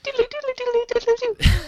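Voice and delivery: high-pitched doodle-doo jingle; silly, high vocal fanfare; homemade sound effect. Pretend. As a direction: imitate a news stinger with your mouth. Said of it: "Yay, sound effects".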